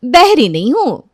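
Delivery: put-on high, loud, irritated girl's voice; annoyed teen girl. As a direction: snap back like a sulky teenager. Sulky girl's catchphrase in story. (चिढ़ी हुई लड़की की ऊँची, तेज़ आवाज़)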